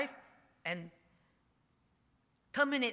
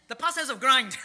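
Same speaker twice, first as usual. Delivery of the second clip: much louder and higher, half-laughing exasperation; exasperated outburst; 7 semitones higher, 13 dB louder than usual. Real, not acted. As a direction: burst out, fed up with forgetting the word. Exasperated at forgotten word.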